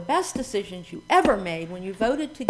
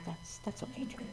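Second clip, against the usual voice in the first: whispered, much softer joke; whispered aside; 18 dB quieter than usual. Real, not acted. Minister joking to congregation about napping, whispered. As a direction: slip it in under your breath.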